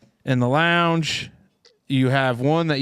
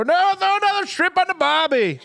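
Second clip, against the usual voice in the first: high hammed-up Aussie accent; Australian accent. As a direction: throw on a jokey Australian accent. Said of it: "British Australian accent".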